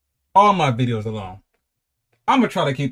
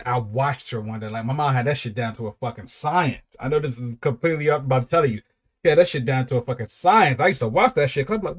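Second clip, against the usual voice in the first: ordinary delivery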